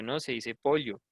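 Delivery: pollo as "POL-yo", with palatal ll; insisted ll pronunciation. Retold or imitated. Teacher insisting on her way, pollo as "POL-yo". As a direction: answer back with pollo said "POL-yo". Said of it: "Y yo".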